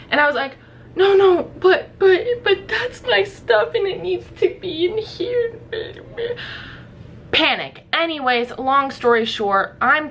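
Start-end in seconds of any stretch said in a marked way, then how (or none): 0.9-5.6 panicked plea. Retold or imitated. Pleading with the security guard, high and panicked. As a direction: beg him in a panic to let the stuff in.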